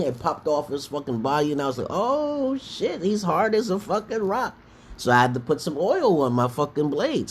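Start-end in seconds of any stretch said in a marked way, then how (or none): 1.9-4.6 high-pitched, drawn-out "oh" of dismay; mock alarm. Player retelling his sword failing on the monster, mock alarm. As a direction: play up the shock in a high voice. Acted reaction to rock monster.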